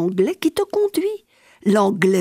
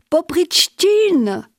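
appel lent, plus haut et plus fort; appel crié; 8 semitones higher, 3 dB louder, much slower than usual. A narrator's reading. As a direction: crier le nom lentement à travers la pièce. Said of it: "il cria". (l'inquisiteur appelle le fou, cri traînant)

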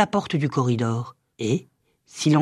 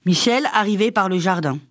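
usual speaking voice

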